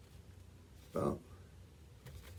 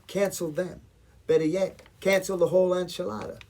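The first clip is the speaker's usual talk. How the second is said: raised, rallying outburst; defiant; 7 semitones higher, 5 dB louder than usual. Acted. Caterer turning on customers who cancel, defiant. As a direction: push it higher and louder as defiance grows.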